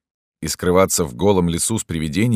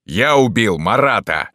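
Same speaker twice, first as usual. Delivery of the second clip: loud, slow, raised boast; defiant shout; 6 semitones higher, 6 dB louder than usual. A narrator's reading. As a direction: yell it back proudly. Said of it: "закричал в ответ Дантес".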